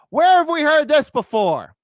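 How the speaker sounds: high, loud rhetorical question; loud, high-pitched challenge; pointed disbelief. Real, not acted. Mocking officials' familiar assurance.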